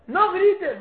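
loud, high-pitched command; parental order. Acted. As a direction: raise your voice to forbid it.

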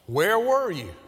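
a hurt cry of where were you; mild pleading reproach. Acted.

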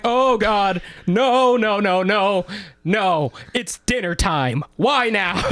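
high wailing no's, laughing; mock despair. Burned cook at dinner rush, wailing despair. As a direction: wail at the timing.

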